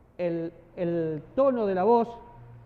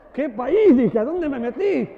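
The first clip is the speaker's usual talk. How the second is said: much louder, higher laughing outcry; laughing disbelief; 4 semitones higher, 11 dB louder, slower than usual. Retold or imitated. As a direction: burst out laughing in shock. Police officer blows him kisses.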